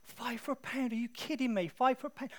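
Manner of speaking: high, loud mock outrage; outraged. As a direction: haggle with theatrical indignation. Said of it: "I'd get all outraged".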